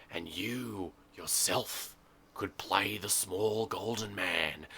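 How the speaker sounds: slow, soft and intimate; hushed and slow. Acted.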